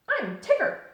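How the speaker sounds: very high, loud bouncy hello; squeaky bright Tigger greeting. Acted. Tigger answering Pooh's hello outside the door.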